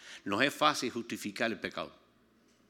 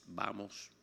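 sin gritar; 2 semitones lower, 9 dB quieter than usual. Retold or imitated. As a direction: order it softly, without raising the voice. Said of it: "Pero sin gritar". (orden en voz baja, sin gritar)

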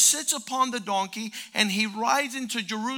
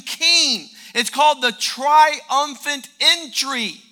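louder and higher, slow and rising; building sermon fervor; 5 semitones higher, 7 dB louder than usual. Acted. Preacher building excitement about the city's celebration. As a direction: preach it up to a climax.

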